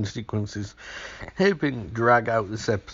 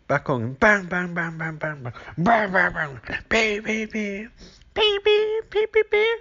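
bang after bang, climbing higher and higher until squeaky; mock gunshots; 11 semitones higher than usual. Retelling Loomis firing at Michael, mock gunshots rising to a squeak. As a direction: rattle off the shots, going up to a squeak.

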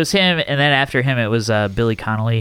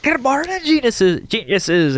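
much higher, hammed-up Scottish greeting; bad Scottish accent, pitched way up; really bad Scottish accent; 11 semitones higher, slower than usual. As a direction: overdo a terrible Scottish brogue. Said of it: "in a really bad Scottish accent".

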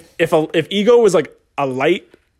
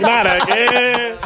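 high, drawn-out mock wail; a stretched-out, higher retold protest; imitating her dismay; 8 semitones higher, much slower than usual. Retelling her reaction to friends, mock dismay. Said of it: "she's like".

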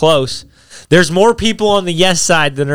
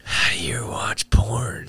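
slow teasing whisper; 4 semitones lower, 7 dB quieter, much slower than usual. Real, not acted. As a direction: lean in and whisper it slowly.

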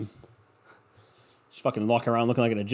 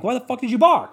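high, louder demand at the dog; mock exasperation; 10 semitones higher, 5 dB louder than usual. Retelling confronting his dog, high exasperated demand. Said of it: "I'm like".

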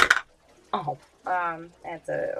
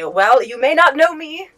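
slow, grand swashbuckling line, higher; 9 semitones higher, 7 dB louder, slower than usual. Acted. Rogue taunting the frog leader, slow heroic outlaw boast. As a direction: announce yourself like a dashing outlaw.

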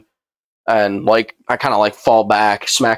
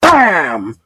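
sudden high, drawn-out bam; imitated crash; 6 semitones higher, 4 dB louder than usual. Storyteller sounds out the table collapse, explosive bam. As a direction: burst out with the impact.

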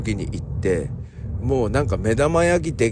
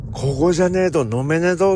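rough Ibaraki dialect, pitched higher; 5 semitones higher, 3 dB louder than usual. Retold or imitated. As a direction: play a gruff Ibaraki local boasting. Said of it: "別に茨城弁にする必要はないんですけど". (茨城弁で高めに荒っぽく)

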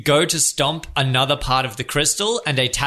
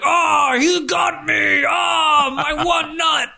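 hammy pained wrestler; 12 semitones higher, slower than usual. Acted. The Battletoad swinging from the bars, shouting in mock agony. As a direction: ham up the groin pain.

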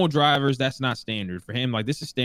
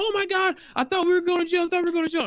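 much higher, panicked friends squealing in relief; freaking out; faster than usual. Retold or imitated. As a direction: imitate terrified friends babbling after the stop. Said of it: "everybody in the car is freaking out".

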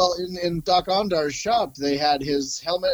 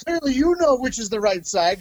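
mock officer; 8 semitones higher than usual. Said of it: "He's like".

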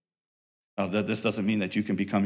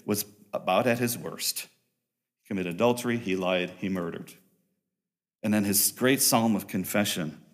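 normal talking voice

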